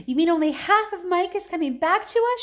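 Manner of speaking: high, loud horrified question; horrified cry. A narrator's reading. Mother cries out about her son, horrified. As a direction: cry out in horror. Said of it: "she cried".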